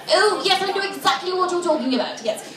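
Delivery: put-on posh, over-polite British accent; be a snooty English gentleman; quick, fussy British politeness; snotty British guy. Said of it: "snotty-nosed British guy who would go".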